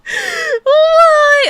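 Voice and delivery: loud high despairing laugh; laugh-crying. Real, not acted. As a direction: wail between laughs. Losing the fight.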